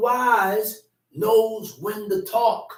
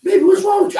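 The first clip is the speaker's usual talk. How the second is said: much higher, louder exasperated yell; exasperated shout; 11 semitones higher, 10 dB louder, much faster than usual. Acted. Husband yelling at snappy wife, exasperated and loud. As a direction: yell it up high at her.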